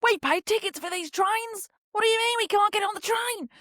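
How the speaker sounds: high-pitched mock Australian tourist kicking off; outraged Australian accent. Tourist protesting about cancelled trains, mock-outraged Australian accent. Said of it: "they're all just kicking off".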